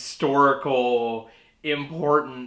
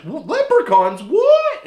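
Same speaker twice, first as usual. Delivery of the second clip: surprised; 14 semitones higher, 10 dB louder, slower than usual. Retold or imitated. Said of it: "Everybody's just like".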